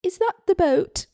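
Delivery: high, loud British housewife; attempted British accent. Mrs. Rogers asking Fred about the boat, attempted British accent. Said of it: "I'm gonna attempt".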